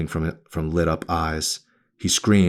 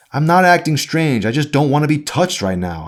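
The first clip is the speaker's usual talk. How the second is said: put-on louder, higher, sharp teenage pushback; angry; 10 semitones higher, 5 dB louder than usual. A narrator's reading. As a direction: snap back at her mother. Daughter snaps back at mother.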